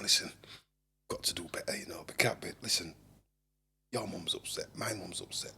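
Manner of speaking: very soft, near-whispered confiding; hushed talk. Retold or imitated. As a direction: keep it under your breath, almost whispering. Hushed talk between cellmates.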